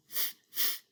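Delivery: "th-, th-" as a breathy unvoiced hiss, tongue between teeth; th sound demo. Shown as a demonstration. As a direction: show the bare "th-" with just air. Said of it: "and you say th".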